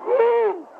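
very high, held "woo"; short falsetto-like whoop. Acted. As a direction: let out a high-pitched woo. Wordless whoop after being mocked.

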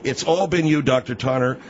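ordinary talk

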